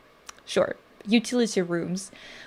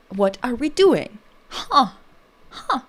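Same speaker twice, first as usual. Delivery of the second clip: higher, puzzled toddler mock-pundit; 4 semitones higher than usual. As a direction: imitate a baby doing a TV commentator. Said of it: "how my toddler would sound".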